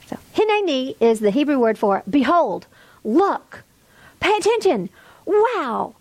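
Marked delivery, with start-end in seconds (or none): none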